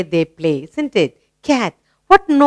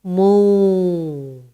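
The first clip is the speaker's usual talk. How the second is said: long mooing cow; 2 semitones lower, 5 dB quieter than usual. Shown as a demonstration. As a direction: stretch the moo like a cow. Drawn-out moo imitation.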